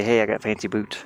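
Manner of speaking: ordinary talk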